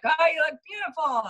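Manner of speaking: awkward forced compliment. Retold or imitated. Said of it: "it felt so awkward in the beginning".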